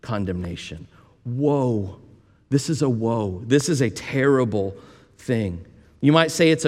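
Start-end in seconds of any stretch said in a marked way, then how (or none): 0.0-0.9 grieving tone. Real, not acted. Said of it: "it's not a heartless condemnation". 1.2-3.4 a soft, mournful woe; a hushed lament of woe; grieving woe. Shown as a demonstration. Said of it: "a grieving condemnation".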